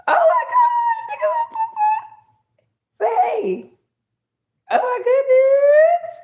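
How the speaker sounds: overwhelmed, half crying; tearful excitement. Real, not acted. Seeing her grandfather's spirit, loud tearful exclamations.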